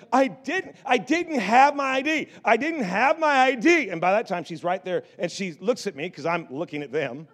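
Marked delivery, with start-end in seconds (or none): 0.1-3.9 put-on higher, pleading old lady voice; grandma's excuse. Grandma calling out her excuse over the loud stands, higher and pleading.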